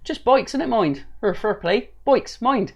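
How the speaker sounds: light Bristolian impression with a rising lilt; Bristolian accent. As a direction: play up the Bristol West Country twang. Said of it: "if you say it in a Bristolian accent".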